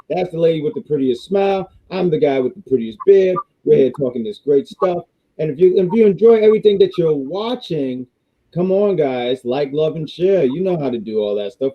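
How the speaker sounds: usual speaking voice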